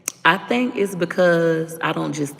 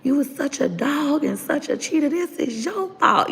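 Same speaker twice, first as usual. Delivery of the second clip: high, strained yelling at his father; angry accusation; 10 semitones higher than usual. Retold or imitated. Fighting with boyfriend, blaming his cheating father, high angry cry. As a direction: throw the blame at him, pitch climbing. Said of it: "I said".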